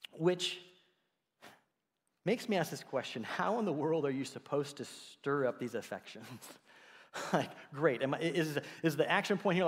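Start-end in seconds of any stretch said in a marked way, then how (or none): none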